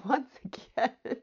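laughing through the words at her own slip. Real, not acted. Host laughing at losing her place, giggling. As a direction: giggle it out, caught off guard.

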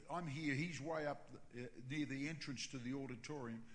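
ordinary talk, nothing marked

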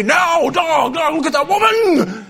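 loud mock devil announcer; Satan as truck announcer. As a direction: tempt like a monster truck promo. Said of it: "when Satan was gonna tempt me".

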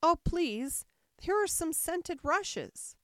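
high, loud excited cry; sudden delight. A narrator's reading. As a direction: exclaim as a delighted little girl. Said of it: "cried in a sudden transport of delight".

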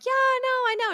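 very high, loud mock-teen protest; imitated teenager insisting, pitch way up; impatient teen. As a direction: brush mom off in a squeaky put-on whine. Imitating teenage self brushing off mom.

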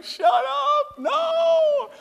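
put-on high childish screaming shout; screaming refusal. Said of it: "screaming and crying".

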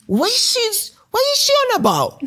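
a bemused Manchester shopkeeper who cannot follow her; puzzled Mancunian shopkeeper. Retold or imitated. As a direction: play the baffled Mancunian trader, half laughing. Shop guy puzzled by her.

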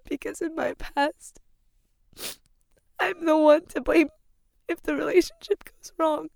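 sobbing out the words for real; crying. Real, not acted. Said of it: "I can't stop crying".

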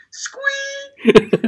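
high, loud laughing squeak; excited squeak. Pretend. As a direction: let out a giddy squeak. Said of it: "Oh, I'm all excited".

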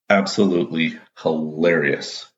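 usual speaking voice

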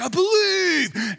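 high, slow declaration of faith; mock heroic Gideon. Gideon declaring faith to God, high and drawn out. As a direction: stretch it out like a proud believer. Gideon voiced with big conviction.

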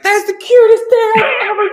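high-pitched squeal of adoration; over-the-top cooing delight; gushing family. Acted. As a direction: squeal it like a doting relative. Imagined family gushing over the newspaper.

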